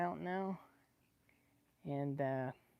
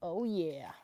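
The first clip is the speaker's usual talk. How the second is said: ordinary talk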